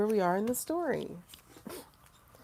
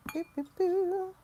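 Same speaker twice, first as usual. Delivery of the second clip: mock ding; 13 semitones higher than usual. Real, not acted. Ding sound while shuffling.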